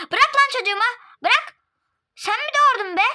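high, loud shout; furious defence. Acted. Mother shouts at the guest to let go of her child, furious. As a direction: protect your child fiercely.

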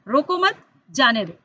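"रोको मत" rising, a break, then falling "जाने दो"; pause after मत. Shown as a demonstration. Teacher shows pupils the third punctuation, pause after मत. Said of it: "रोको मत" के बाद लगाते हैं".

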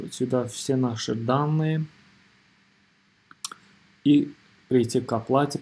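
ordinary delivery

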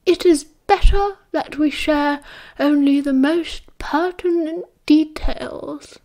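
clipped caution in a near whisper; sharp old wizard. A narrator's reading. Dumbledore warns the room, sharply and hushed. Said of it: "Dumbledore said sharply".

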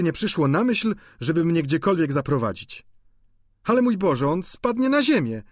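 high, chattering landlady; gushing landlady. A narrator's reading. Landlady gushing about Vautrin and scolding Goriot. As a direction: prattle on excitedly.

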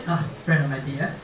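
usual speaking voice

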